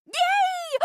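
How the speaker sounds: high, squealed "yay"; delighted cheer. Acted. Actor thrilled someone will join, squealing.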